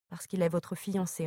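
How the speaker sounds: plain reading voice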